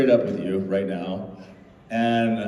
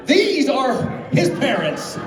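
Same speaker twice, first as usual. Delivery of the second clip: high, slow, laughing reveal; mock triumphant presentation; triumphant announcement; 12 semitones higher, slower than usual. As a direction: announce it like a gotcha. Jen presenting parents triumphantly.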